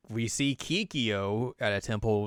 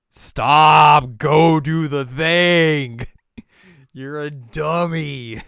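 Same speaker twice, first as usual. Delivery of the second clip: loud, high, drawn-out shout; hammed-up scolding; 4 semitones higher, 12 dB louder, much slower than usual. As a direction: bellow each order slowly in mock exasperation. Sango yells orders at Inuyasha.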